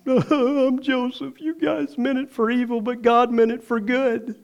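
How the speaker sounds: lightly voiced weeping Joseph revealing himself; understated tearful Joseph; Joseph crying. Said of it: "He starts crying at the table".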